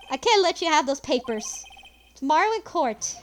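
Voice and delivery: ordinary delivery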